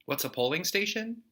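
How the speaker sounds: usual speaking voice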